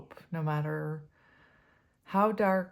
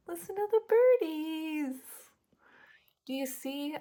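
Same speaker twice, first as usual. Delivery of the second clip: delighted; 9 semitones higher than usual. Real, not acted. Vlogger telling viewers to listen to the birds, delighted. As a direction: point out the birds with glee.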